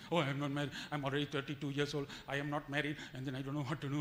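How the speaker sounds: mock-anxious lament, pitched low; hammed-up fretting of an unmarried devotee; worried single person. Preacher mimics a fretting unmarried listener, low mock whine.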